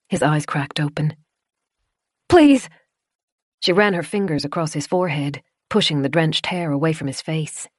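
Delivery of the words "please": high, loud plea; begging cry. Acted. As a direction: cry out please. Begging him to wake.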